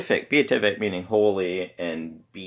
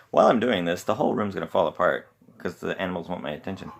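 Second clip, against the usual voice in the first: normal talking voice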